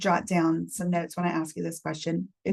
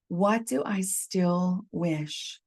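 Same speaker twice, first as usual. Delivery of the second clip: slow reflective question; much slower than usual. Shown as a demonstration. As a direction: ask it slowly, like a question to yourself. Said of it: "you're asking this to yourself".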